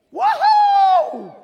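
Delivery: very high, very loud shouting; wild cheering. Pretend. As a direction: celebrate like a wild fan. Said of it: "I think he does this".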